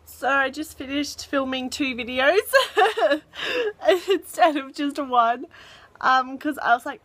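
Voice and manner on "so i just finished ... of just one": higher-pitched, laughing through the words. Real, not acted. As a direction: break into real giggles while admitting it. Amused at own filming mix-up.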